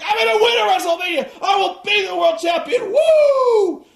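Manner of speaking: very high, loud hyped yell ending in woo; Flair promo shout. Retold or imitated. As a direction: hype the crowd like a wrestler on the mic. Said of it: "He's like".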